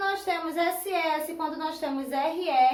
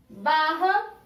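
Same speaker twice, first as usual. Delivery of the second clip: normal talking voice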